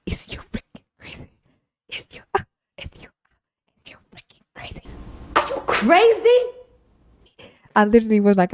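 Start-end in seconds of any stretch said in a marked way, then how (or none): 0.1-4.8 voiceless, hushed disbelief; incredulous whisper. Retold or imitated. Server confronting customer over candy drama, whispered incredulity. 5.4-6.4 very high, slow, drawn-out disbelief; exaggerated high-pitched mock outrage; incredulous squeal. Server confronting customer over candy drama, squealed incredulity.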